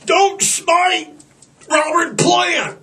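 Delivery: high, pleading stoner voice; whiny high dude voice; stoner plea. Retold or imitated. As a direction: beg him like a panicked stoner. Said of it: "I'm like".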